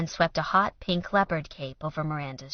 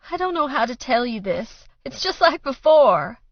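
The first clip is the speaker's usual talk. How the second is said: tearful voice climbing high and loud; weeping, rising; 8 semitones higher, 6 dB louder than usual. A narrator's reading. Mother weeping to daughter, rising to a loud wail. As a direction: let the tears break it open. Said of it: "her mom wept".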